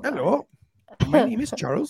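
high-pitched call-centre introduction; tech-support agent. Acted. Host mimics a tech-support worker using a false name, put-on agent voice. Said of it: "talk to the techies".

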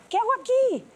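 very high, mounting disbelief; alarmed realization. Retold or imitated. Woman waking inside a toxic relationship, high alarmed disbelief. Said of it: "desperté y fue así de".

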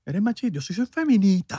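high, put-on caricature voice; mock feminist. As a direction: play a preachy activist for laughs. Mocking a stereotyped feminist.

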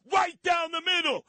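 high shouted mock call.

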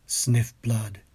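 ordinary talk

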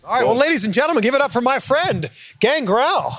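hyped crowd intro, calling out; big ring announcer welcome. Real, not acted. Introducing guest to live crowd.

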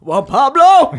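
loud, high, laughing cry of Juan Pablo; Angela Bassett impression. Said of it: "how did Angela Bassett say Juan Pablo".

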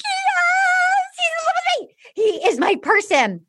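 high, tearful gushing quote; mock teary high gush; Claire gushing. Claire voiced gushing on TV show.

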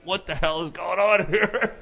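high, drawn-out mock disbelief; astonished outburst. Storyteller recalling a wild scene, astonished. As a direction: stretch it out as retold bewilderment.

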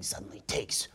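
whisper. Acted. Dramatic narration of the pitch.

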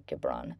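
"Gibran" with a hard g, low and soft; hard-g pronunciation. Real, not acted. Host unsure of the author's name, "Gibran" with hard g. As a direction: offer "Gibran" with a hard g as the doubtful alternative. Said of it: "I don't know how to say that".

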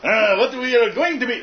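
put-on high nasal alien accent; Watto voice. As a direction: slip into the junk dealer voice. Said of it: "that slipped into Watto there".

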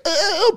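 high squealed uh; sharp high yelp; imitated tic noise. Shown as a demonstration. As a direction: blurt a sudden high tic sound. Imitating his tic before beatboxing.

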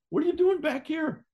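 surprised shout. Retold or imitated. Retelling confronting the returning wrestler.

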